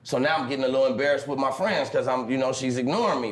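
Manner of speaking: normal talking voice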